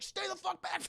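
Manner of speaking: high, strained mock scream; panicked warning. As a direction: yell to hold attackers off. Killers coming toward him.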